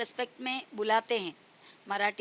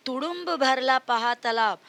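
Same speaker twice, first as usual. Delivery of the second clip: put-on sing-song, louder recital of a children's poem; poem recitation; 2 semitones higher, 6 dB louder than usual. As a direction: recite the verse loudly for kids. (बच्चों को कविता सुनाते हुए, ज़ोर से गाकर पढ़ना)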